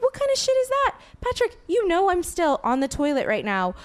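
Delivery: high-pitched indignant scolding; mock incredulous protest; retold outrage. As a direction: scold a boyfriend who barged back too soon. Acted outrage at boyfriend's early return.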